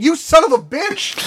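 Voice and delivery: high, slow, outraged cry; mock outraged yell. Retold outburst at Netflix.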